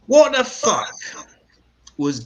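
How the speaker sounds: loud high what the fuck; mock outrage. Comic ranting about the inauguration, loud mock outrage. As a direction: blurt it out in fake outrage.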